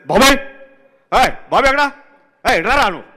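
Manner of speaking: high, loud shout for Bobby; director calling. Retold or imitated. Retelling how the director shouts for Bobby, loud and high. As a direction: call out sharply on arrival. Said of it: "టక్ టక్ వచ్చేస్తారు".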